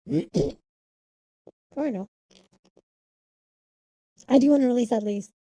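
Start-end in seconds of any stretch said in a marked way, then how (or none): 4.3-5.3 excited. Real, not acted. Streamer declaring her big goal.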